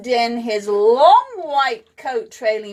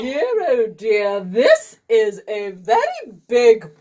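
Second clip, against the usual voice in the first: posh doctor voice. A narrator's reading.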